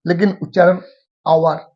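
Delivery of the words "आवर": "आवर" starting straight on the vowel, no h; silent H. Shown as a demonstration. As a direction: drop the H from "आवर". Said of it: "एच का उच्चारण नहीं होता है".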